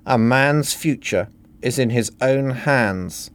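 ordinary delivery, nothing marked

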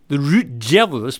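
slow, anglicised stab at "Route Devils"; mispronounced Belgian nickname. Shown as a demonstration. Host knowingly getting the Belgian team nickname wrong, mispronounced. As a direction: say "Route Devils" slowly, as an English guess. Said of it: "I know that's wrong".

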